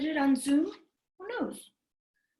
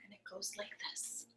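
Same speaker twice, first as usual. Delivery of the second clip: whisper; 4 semitones lower, 21 dB quieter than usual. Real, not acted. Leading into the song.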